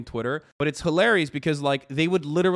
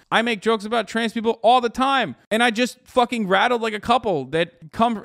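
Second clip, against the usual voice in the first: raised, louder heated rant; rising rant voice; 7 semitones higher, 5 dB louder than usual. Real, not acted. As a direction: push it out loud and worked up.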